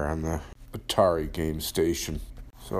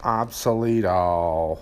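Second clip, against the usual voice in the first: drawn-out call; 7 dB louder, slower than usual. Pretend. Calls out his show persona.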